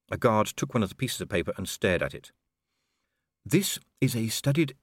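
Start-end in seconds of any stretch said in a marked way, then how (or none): 3.4-4.8 out of the corner of mouth. A narrator's reading. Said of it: "speak out of the corner of his mouth".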